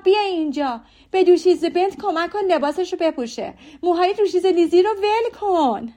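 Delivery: high, loud, hurried calls up the stairs; a flustered mother shouting for her daughter; frantic mother calling out. A narrator's reading. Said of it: "داد زد".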